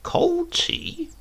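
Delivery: hammed-up disbelief; Silas innocent surprise. A narrator's reading. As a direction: feign wide-eyed innocence. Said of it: "Silas said".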